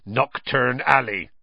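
slow, grim and heavy. Acted. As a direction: say it darkly, disapproving. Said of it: "said Hagrid grimly".

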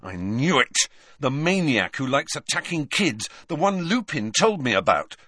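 mildly heated outburst, pressing on through; angry. A narrator's reading. Harry recognising the werewolf who attacks kids, angry. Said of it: "said Harry angrily".

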